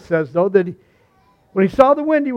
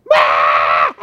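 imitated full-volume scream, long drawn out; loud acted shriek of horror; screaming bloody murder; 14 semitones lower, 8 dB louder than usual. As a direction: scream it like the terrified mother. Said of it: "starts screaming, bloody murder".

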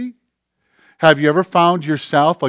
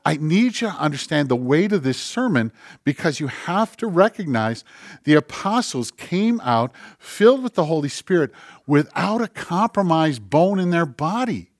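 ordinary delivery

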